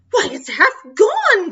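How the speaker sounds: high, loud cry of dismay. Acted. Captain crying out at the nibbling mouse, high and loud. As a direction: cry out at the ruined cutlass. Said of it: "cried the captain".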